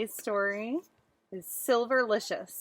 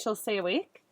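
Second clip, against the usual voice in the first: plain reading voice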